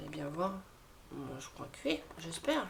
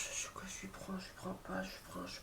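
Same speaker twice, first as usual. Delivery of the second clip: whispered dithering; 9 dB quieter than usual. Retold or imitated. Retelling hesitation at the computer.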